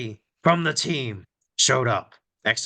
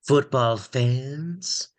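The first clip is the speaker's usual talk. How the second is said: booming villain voice; 2 semitones higher than usual. Pretend. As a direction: greet the fans like a villain. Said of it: "I'm just going to try out various villain voices".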